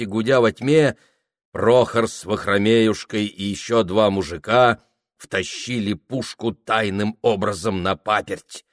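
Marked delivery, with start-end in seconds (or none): none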